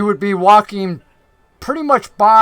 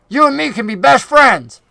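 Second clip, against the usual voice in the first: hearty, higher southern goblin; chummy southern goblin, pitched up; Finch, southern accent; 6 semitones higher, 4 dB louder than usual. Acted. Goblin slapping Clack on the shoulder, hearty southern-accented chumminess. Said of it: "the goblin from the north with the southern accent".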